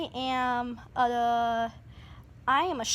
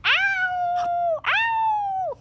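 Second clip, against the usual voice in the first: mock ow. Pretend hurt again.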